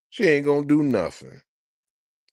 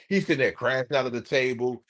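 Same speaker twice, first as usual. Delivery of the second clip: usual speaking voice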